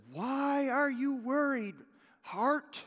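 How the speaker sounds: David speaking to his heart. Retold or imitated. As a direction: ask your own heart gently why it worries. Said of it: "He says".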